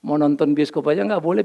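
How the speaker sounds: usual speaking voice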